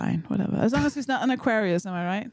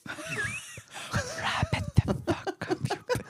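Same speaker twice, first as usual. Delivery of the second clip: hushed, drawn-out mock mouthing; imitated mouthing; 6 dB quieter, much slower than usual. Host imitating Claire silently telling the guest to finish, hushed mouthing. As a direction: mouth it slowly under your breath, imitating someone. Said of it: "didn't mouth the word fringe. She just literally just went".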